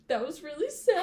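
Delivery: high, loud mock sob; imitating brother crying. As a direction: play the bawling kid brother. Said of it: "And he was like".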